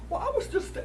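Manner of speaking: mock-indignant coyote; coyote bristling. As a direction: take offence as the coyote. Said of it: "he says".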